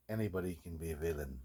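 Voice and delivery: sinister villain line. Acted. A guy in his workshop hisses the villain line menacingly. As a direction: let it sink down like a threat. Dramatic hero vs villain lines.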